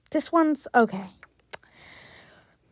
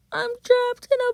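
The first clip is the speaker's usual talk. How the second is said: high, hammed-up cry for help; melodramatic wail; 11 semitones higher, 4 dB louder than usual. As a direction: wail it like a trapped victim. Locked out in game, melodrama.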